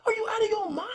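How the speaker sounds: breathless whispered repeat, high and strained; whispered mock disbelief. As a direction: hiss it in shock at her gall. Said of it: "I'm like".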